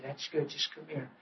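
very soft, near-whispered comfort; hushed soothing. Retold or imitated. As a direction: murmur to the boy so he calms. Father soothes recovered son.

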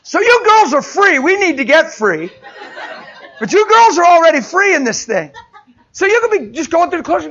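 loud and high, laughing as you urge; laughing exhortation. Real, not acted. Preacher urging the women in the pews, laughing loudly. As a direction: cheer the ladies on mid-laugh.

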